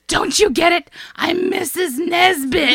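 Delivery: high, loud, hammed-up breakdown; Buzz Lightyear losing it. Podcaster quoting the tea-party spaceman, unhinged high cry. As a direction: imitate a toy hero cracking up in mock despair. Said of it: "He's losing his mind a little bit".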